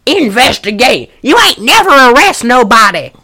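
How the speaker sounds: high, loud hillbilly twang; Appalachian accent. Retold or imitated. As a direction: yell it like an old country granny. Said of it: "Is that a good impression of her".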